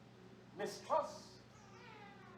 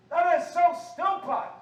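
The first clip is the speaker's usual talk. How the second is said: high, very loud preacher's outburst; shouted scolding; indignant shout; 9 semitones higher, 11 dB louder than usual. Real, not acted. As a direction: blast the congregation in exasperation. Repeats it as a shout.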